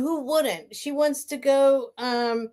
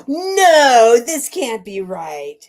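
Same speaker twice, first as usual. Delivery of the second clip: loud stunned no; 8 dB louder, much slower than usual. Real, not acted. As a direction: refuse to believe it, out loud. Disbelief at the news.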